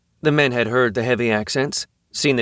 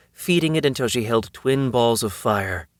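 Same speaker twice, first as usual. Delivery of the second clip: usual speaking voice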